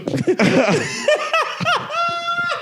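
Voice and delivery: shrieking burst of laughter. Real, not acted. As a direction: let a big high laugh out. Cracking up at [name] story.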